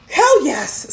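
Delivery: loud delighted exclamation; thrilled yes. Retold or imitated. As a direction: shout it like you won a prize. Thrilled at holiday invitation.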